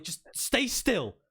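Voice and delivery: high, drawn-out fussing command; scolding a child. Acted. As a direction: order a squirming child to hold still. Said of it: "as if he's talking to one of his boys".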